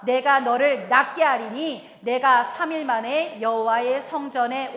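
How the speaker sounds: loud, slow, measured unison-style reading; scripture recitation. Real, not acted. Preacher leading the congregation in verse, slow loud recitation. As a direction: declaim it as God's own voice.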